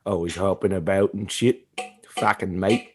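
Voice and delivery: low-key Australian grumble; Australian accent. Acted. Host repeats old kangaroo bit, mock Australian. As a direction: put on a broad Australian rant. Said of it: "where I was like".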